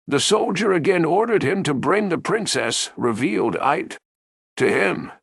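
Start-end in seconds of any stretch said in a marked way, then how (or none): none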